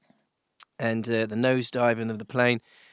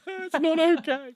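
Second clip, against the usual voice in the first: much higher, slow, strained squeak of panic; panicked reply; slower than usual. Retold or imitated. Husband answering calm wife on falling plane, panicked high squeal. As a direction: squeal it like you're losing it.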